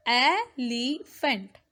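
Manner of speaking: elephant slowly as e, le, phant; syllable by syllable. Shown as a demonstration. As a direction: break elephant into three beats. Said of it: "is having three syllables".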